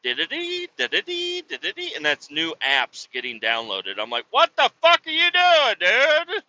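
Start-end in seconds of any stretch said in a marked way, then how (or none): 0.0-1.9 high sing-song notification jingle; light, very high "da-da-dee" beeps; imitated phone chime. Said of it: "I hear it going". 4.3-6.4 loud, high retold scolding; squawking outraged shout; exasperated yell. Retold yelling at son.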